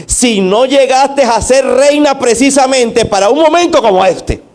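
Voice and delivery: impassioned acted rebuke from Mardoqueo; forceful challenge. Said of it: "Le está diciendo Mardoqueo".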